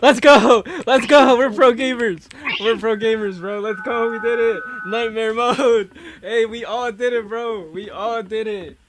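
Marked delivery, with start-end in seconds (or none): 0.0-7.7 loud, high victory cheers; excited cheering. Real, not acted. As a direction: cheer the win at full volume. Winning the game, cheering.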